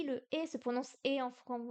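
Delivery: usual speaking voice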